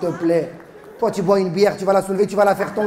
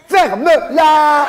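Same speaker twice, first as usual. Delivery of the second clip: drawn-out, much louder shout of ferme-la; mock shout; 8 semitones higher, 8 dB louder, much slower than usual. Comedian on stage snaps at a chatty child, slow mock shout. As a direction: bark it slowly at the kid in fake anger.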